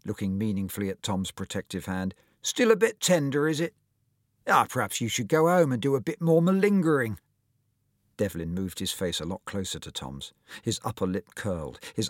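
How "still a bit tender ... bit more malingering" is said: higher, louder sneering jibe; bully mocking the injured boy; mocking taunt. A narrator's reading. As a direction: needle him about his shoulder.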